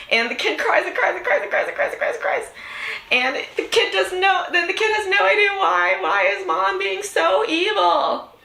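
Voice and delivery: high, giggling through the story; laughing. Real, not acted. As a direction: laugh while telling it. Laughing while telling kid story.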